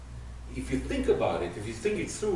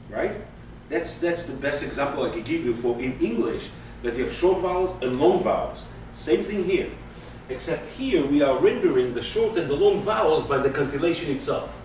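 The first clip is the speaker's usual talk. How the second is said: ordinary delivery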